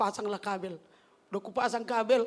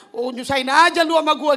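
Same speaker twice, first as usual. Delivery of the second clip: annoyed inner grumble; 10 dB louder than usual. Retold or imitated. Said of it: "gitu ya kan".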